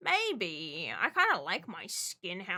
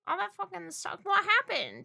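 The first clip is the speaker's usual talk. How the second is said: ordinary talk, nothing marked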